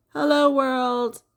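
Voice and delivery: high, slow sing-song greeting; playful hello. Pretend. Teacher voices the opening saying hello to the world. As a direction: greet the world like a cheerful toy.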